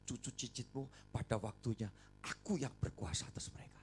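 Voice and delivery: whispered menacing threat; whispered voice of Satan; sinister whisper. Retold or imitated. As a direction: hiss the devil's claim softly.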